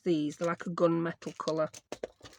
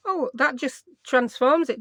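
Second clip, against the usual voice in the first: ordinary talk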